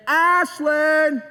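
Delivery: calling out. Retold or imitated. Dad calls a second time for daughter, calling out. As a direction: sing the name out to find her. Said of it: "Second call, I hear nothing".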